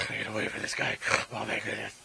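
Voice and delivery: lightly voiced panicky cartoon pug. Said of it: "I wanna hear your best, like, pug voice".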